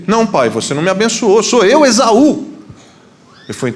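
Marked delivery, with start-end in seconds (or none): 0.1-2.4 high, quick protest swelling on the name; hammed-up rising insistence; acted indignant protest. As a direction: insist urgently to your father. Son protests in acted dispute.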